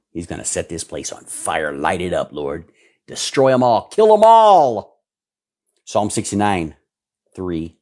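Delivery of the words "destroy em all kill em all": high, loud, slow shout; a drawn-out preacher's shout, much higher and louder; shouted cry. Real, not acted. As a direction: cry it out to the Lord, stretched and fierce. Preacher crying out for judgment.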